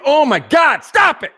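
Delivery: shrieked very high and loud, mocking; shrill mock squeal of "stop it". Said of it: "When you say".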